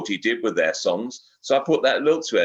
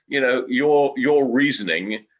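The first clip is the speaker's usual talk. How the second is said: ordinary talk, nothing marked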